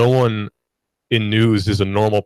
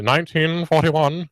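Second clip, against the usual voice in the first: put-on higher, slow old broadcast North Atlantic accent; 6 semitones higher, slower than usual. Host imitating early radio broadcasts, North Atlantic accent. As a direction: mimic a forties North Atlantic newsreel accent. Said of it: "what they call the North Atlantic, uh, accent".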